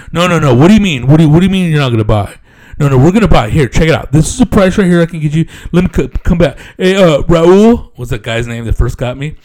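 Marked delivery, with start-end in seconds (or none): 0.1-8.0 hammed-up pushy dealer, higher and louder; mock the salesman pressing hard; a mock car dealer who won't let go.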